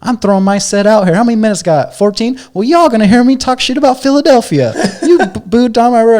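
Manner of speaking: high, loud ranting comic impression; imitated angry rant. Comic scolding Philly crowd for booing his friend, loud rant. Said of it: "But he says".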